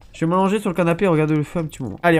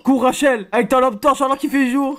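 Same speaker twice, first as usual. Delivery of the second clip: loud, higher call to run; urging shout; 8 semitones higher, 4 dB louder than usual. Pretend. Streamer urging the heroine to flee, loud and high. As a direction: yell at her to run.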